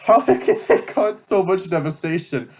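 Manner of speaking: laughing at the drama; mocking villain, laughing. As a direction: echo the rant while giggling. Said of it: "how overdramatic".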